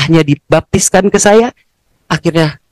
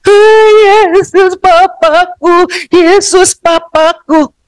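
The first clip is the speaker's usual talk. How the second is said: high, slow tearful wail; acted sobbing adoration; crying out to Jesus; 12 semitones higher, 3 dB louder, much slower than usual. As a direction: cry it out drawn-out, overwhelmed. Acted overwhelmed cry to Jesus.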